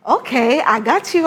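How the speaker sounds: ordinary talk, nothing marked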